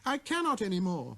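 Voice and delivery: put-on high, abashed child's voice; little prince murmuring. A narrator's reading. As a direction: stammer it out, embarrassed. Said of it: "murmured the little prince".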